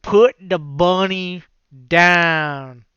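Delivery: slow, loud mocking drawl of a bad movie accent; bad Cage accent. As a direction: ham up his bad accent, stretching each word. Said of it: "His accent is so bad".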